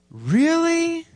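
gasp, then a very high 'really?'; thrilled little girl. Acted. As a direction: react like an amazed cartoon kid. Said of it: "I'll read the cartoon to you".

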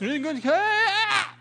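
high strangled croaking cough. Retold or imitated. Singer's throat gives out, strangled croak. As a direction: let the voice crack into a cough. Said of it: "until finally his throat croaks".

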